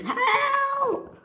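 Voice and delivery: long, very high squeals at ordinary volume; scream. A narrator's reading. Annie with no one in sight, scream. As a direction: wail it out in a tiny high squeal. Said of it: "she screamed".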